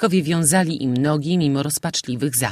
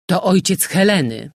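firm ringing command, a bit higher; 4 semitones higher, 4 dB louder than usual. A narrator's reading. Captain to his crew, firm ringing announcement. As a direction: announce it so nobody argues. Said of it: "dźwięcznym i stanowczym głosem".

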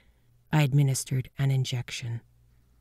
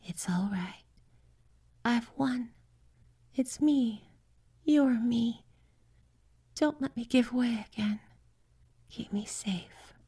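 high, soft, fading tender voice; soft tender whisper, nearly gone; tender and weak; 9 semitones higher, 5 dB quieter than usual. A narrator's reading. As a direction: comfort him with your last strength. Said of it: "a tenderness I had never imagined".

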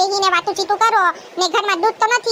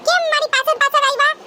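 put-on higher girlish voice, a little defensive; young bride answering her sister-in-law, higher voice; young bride's voice; 6 semitones higher than usual. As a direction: ask why she followed, like a wary young bride.

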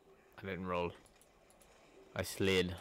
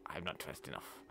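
whispering; 8 semitones lower, 12 dB quieter than usual. Acted. Whispered self-correction.

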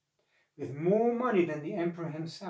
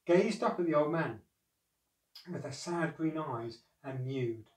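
usual speaking voice

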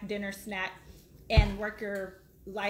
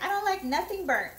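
a light, higher mock of a fussy daughter; imitating her daughter; 8 semitones higher than usual. Mother quotes her picky daughter about burnt food, mock higher voice. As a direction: play the picky kid complaining. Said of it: "my daughter is like".

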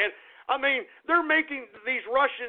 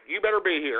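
normal talking voice

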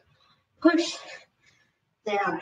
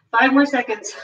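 breathless from the workout; winded coach talk; out of breath; 4 semitones higher than usual. Real, not acted. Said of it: "I'm a bit out of breath here".

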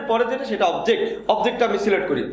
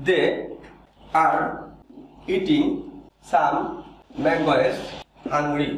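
word-by-word dictation; 6 semitones lower, much slower than usual. Shown as a demonstration. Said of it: "খেয়াল করো".

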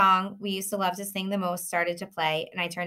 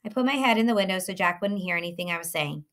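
usual speaking voice

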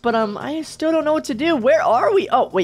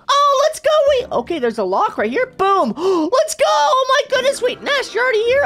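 excited shout; 12 semitones higher than usual. Real, not acted. Unlocked the lock.

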